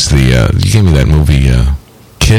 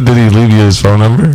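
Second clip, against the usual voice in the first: ordinary delivery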